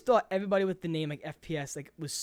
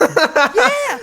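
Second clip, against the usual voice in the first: excited laughing yell; 9 semitones higher, 12 dB louder than usual. Real, not acted. Excited reply to run Tog.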